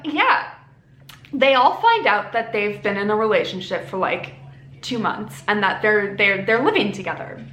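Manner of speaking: ordinary talk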